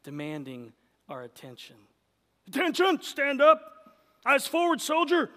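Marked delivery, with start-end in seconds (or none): 2.5-5.3 barking drill sergeant commands. Pretend. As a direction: shout orders like a drill sergeant. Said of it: "like a drill sergeant demanding our attention".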